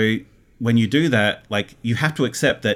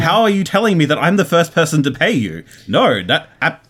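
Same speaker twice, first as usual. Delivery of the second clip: raised, louder, incredulous outburst; indignant disbelief; 6 semitones higher, 5 dB louder than usual. Retold or imitated. Retelling to the hosts how she learned they weren't paid, indignant and louder. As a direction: replay the outrage at hearing she's unpaid. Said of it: "I was very angry for, like, a good hour".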